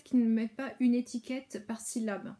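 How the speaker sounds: normal talking voice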